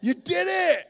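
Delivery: high, loud jubilant cheering; dad cheering his son who made it; overjoyed dad. Retold or imitated. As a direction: celebrate like a proud dad. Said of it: "he's like".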